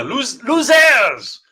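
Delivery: loud, high hammed-up French cry; put-on French accent, crowing an insult. Echoing the French character to the co-host, loud mock French accent. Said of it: "the French accent thing".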